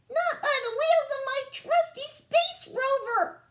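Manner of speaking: very high, bold alien cry; squeaky hero standing firm; brave little alien. A narrator's reading. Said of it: "cried Bork bravely".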